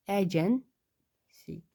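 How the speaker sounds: Agency stretched out slowly while writing; slow drawn-out dictation. Shown as a demonstration. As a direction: draw out agency syllable by syllable as you write it.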